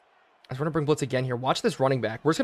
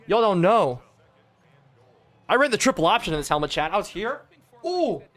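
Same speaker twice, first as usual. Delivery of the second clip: ordinary delivery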